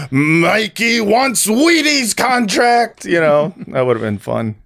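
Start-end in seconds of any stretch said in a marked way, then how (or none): 0.1-3.0 slow, high Larry David whine; drawn-out Larry David voice; Larry David impression. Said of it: "They should have had Larry David do his voice".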